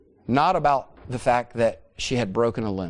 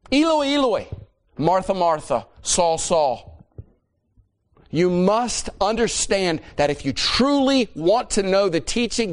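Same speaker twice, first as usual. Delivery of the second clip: raised, high-pitched preaching with heavy emphasis; fervent preaching; 7 semitones higher than usual. Real, not acted. Preacher urging the congregation to know the Old Testament, fervent preaching. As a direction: press each point home in a higher, insistent pulpit voice.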